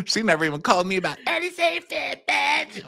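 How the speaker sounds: high mock whine of a petty housewife; exaggerated high nasal-free mimic whine. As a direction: whine it up high, mocking her. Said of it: "that was the thing".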